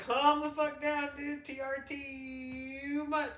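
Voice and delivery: high, slow fed-up dude; put-on high bro voice; mock bro voice. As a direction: drawl it like a chill bro. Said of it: "I was like".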